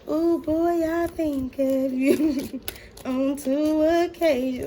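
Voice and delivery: lover's voice, high and dragged out slowly; voicing a smitten lover. Tarot reader voicing the Scorpio's thoughts, slow and swooning. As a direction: play the swooning lover, teasing.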